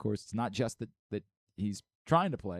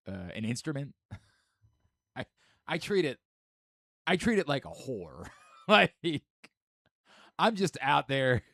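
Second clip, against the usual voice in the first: ordinary delivery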